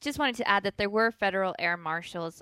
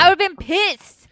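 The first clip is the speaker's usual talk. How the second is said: outraged; 9 semitones higher, 8 dB louder than usual. Real, not acted. Outraged reaction to needle story.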